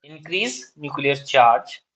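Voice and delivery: slow, deliberate dictation, each phrase spaced out. Shown as a demonstration. Teacher dictating exam answer to students, slow dictation. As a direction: read out notes word by word for students to copy.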